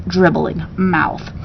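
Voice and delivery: ordinary delivery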